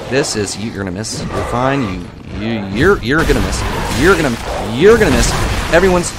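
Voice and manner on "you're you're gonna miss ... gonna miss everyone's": louder and higher, building taunt; taunting the enemy, building to a high, loud jeer; rising taunt. Real, not acted. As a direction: jeer harder each time.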